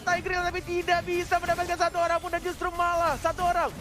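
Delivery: loud high hype call; esports caster. Real, not acted. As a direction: yell out the big combo. Caster reacts to big combo.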